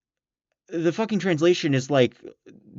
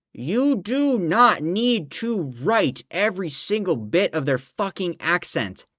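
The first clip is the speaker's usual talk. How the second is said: slow, higher, every word punched separately; emphatic exasperation; 3 semitones higher, slower than usual. Real, not acted. Reviewer ranting about accents written out phonetically, slow exasperated emphasis. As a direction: hammer each word out in exasperation.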